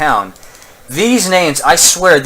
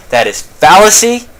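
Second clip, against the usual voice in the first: indignant objection; 8 semitones higher, 7 dB louder than usual. Acted. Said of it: "might say".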